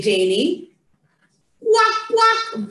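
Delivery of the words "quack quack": two high, quick quacks; duck quack. Shown as a demonstration. Showing Janie what the duck says, high quacking. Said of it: "What does the duck say".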